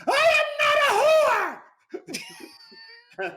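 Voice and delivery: high, loud mock scream; screamed line. Co-star screaming at the warden on set, a hammed-up shriek. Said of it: "and she'd scream".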